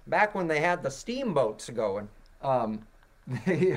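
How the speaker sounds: lightly voiced old-timer; old-timey voice. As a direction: tell it like a grandpa remembering steamboats. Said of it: "old-timey voice".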